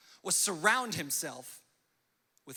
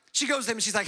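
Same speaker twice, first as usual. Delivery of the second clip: usual speaking voice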